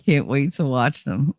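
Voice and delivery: normal talking voice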